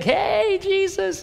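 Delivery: very high, big-smile hello; excited greeting. Acted. Smiling crowd greets Jesus on finding him, gushingly excited. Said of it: "They're all excited. Their smiles are all big".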